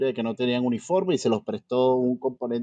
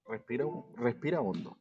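soothing; 3 semitones lower, 12 dB quieter than usual. Pretend. Calming agitated co-host.

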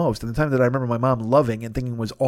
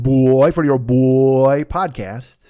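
slow, drawn-out mock boy voice; 4 dB louder, much slower than usual. Recalling his own podcast bit, slow drawn-out voice. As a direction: stretch each word out lazily. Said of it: "remember I did that?".

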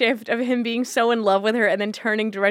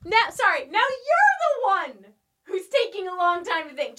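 squeaky teasing; 9 semitones higher than usual. Acted.

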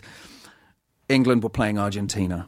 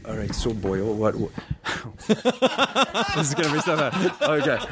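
nervous laugh while warming up; giggling at himself before trying the voice; laughing nervously; 3 semitones higher than usual. Real, not acted. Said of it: "get myself into it".

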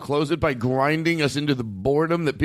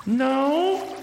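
much higher put-on celebrity voice; Jay Leno; 11 semitones higher than usual. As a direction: squeak it out like the talk-show host. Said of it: "play with some voice things".

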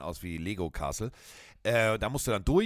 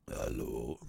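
much lower, booming-deep hello of a giant linebacker; Warren Sapp's deep voice; 12 semitones lower, 6 dB quieter than usual. Retold or imitated. Imitating the huge football legend greeting someone, very deep voice. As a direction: drop way down and rumble the greeting. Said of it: "eine Stimme, die klingt ungefähr so".

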